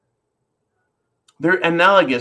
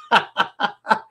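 high burst of cackling; derisive laugh; 6 semitones higher than usual. Real, not acted. Host amused at [name] upsetting the left, cackling laugh. Laughs at his own joke.

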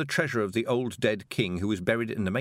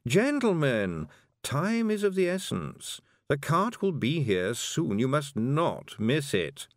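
higher, slow and formal toad; a prim toad urging them on; 6 semitones higher, slower than usual. Acted. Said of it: "This was the voice of the toad".

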